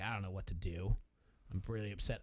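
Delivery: low, subdued mock upset; retold upset. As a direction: lightly act out being let down. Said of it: "he's all upset".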